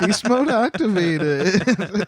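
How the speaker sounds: high, slow announcement through laughter; drawn-out mock title, giggling; laughing announcement. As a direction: crack up while repeating the grand title. Repeats the title joke amid laughter.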